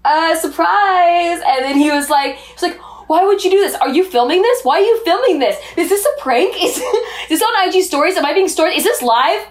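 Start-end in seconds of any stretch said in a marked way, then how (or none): 0.0-1.4 high, slow, drawn-out reveal; sheepish surprise. Retold or imitated. Naked wife to her startled husband, sheepish. 3.1-9.4 high, frantic mock questioning; freaking out. Husband suspecting a filmed prank, freaking out. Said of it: "freaking out".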